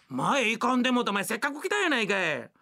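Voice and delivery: normal talking voice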